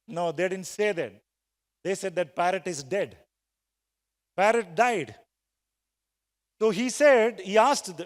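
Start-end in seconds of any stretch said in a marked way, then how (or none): none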